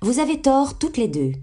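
high-pitched, bossy elder sister; grown-up tone. A narrator's reading. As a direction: scold both like a self-appointed adult. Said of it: "prendre un ton de grande personne". (prendre un ton de grande personne)

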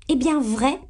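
high, rising, offended question; indignant exclamation. A narrator's reading. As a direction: exclaim in disbelief, pitch jumping up. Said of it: "d'un ton indigné". (s'exclamer d'un ton indigné)

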